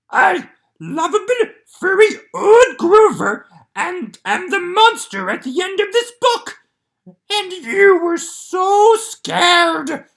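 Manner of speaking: high, loud, slow furry monster shouting; a panicked puppet monster begging the reader; Grover voice. Acted. Grover voice reading.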